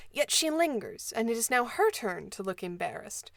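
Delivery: usual speaking voice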